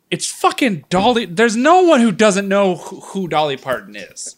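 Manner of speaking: high, loud, incredulous insistence; exasperated rant. Real, not acted. Host insisting everyone knows [name], exasperated rant. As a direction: pitch it up in outraged disbelief.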